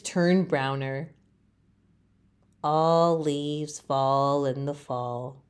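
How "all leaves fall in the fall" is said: low, soft, slow resigned thought; defeated little leaf. Acted. Leaf thinks it sadly, low and soft. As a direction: say it to yourself as if giving up. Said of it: "he thinks".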